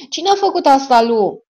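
loud, high, shouted question; shocked demand. Acted. Horrified man confronts his friend over the body, shouted demand. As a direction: demand an answer at the top of the voice.

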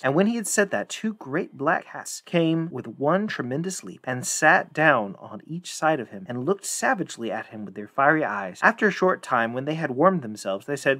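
ordinary delivery, nothing marked

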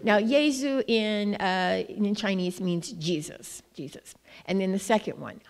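ordinary talk

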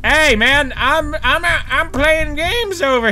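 high, slow whiny complaint; mock annoyed protest. Gamer complaining to the neighbor in a mock whine. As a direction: act put out at the interruption.

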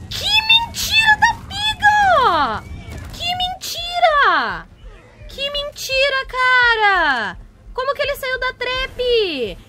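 high, drawn-out wail of disbelief; squealing, incredulous protest; outraged disbelief. Real, not acted. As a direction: wail it, stretching each phrase. Outraged as creature escapes trap.

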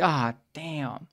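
ordinary talk, nothing marked

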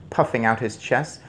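ordinary delivery, nothing marked

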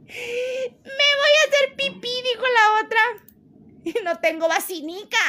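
very high squeal, near tears laughing; helpless laughter. Real, not acted. Streamer about to wet herself laughing, high squeaky laughter. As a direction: squeeze the words out through a laughing fit. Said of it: "ya no aguanto".

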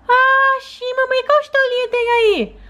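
put-on high childlike voice of a small daughter; little girl voice. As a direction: play the sick little girl talking to mom. Doll asks about story.

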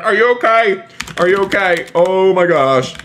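high, alarmed fussing over a victim; mock panic. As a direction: play up the alarm after an accident. Checks on run-over friend.

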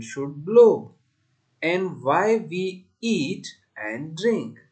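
slow, deliberate verse with stretched words; poem recitation. Shown as a demonstration. Teacher reciting a poem for class four, slow deliberate recitation. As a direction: recite it line by line, loud and measured.

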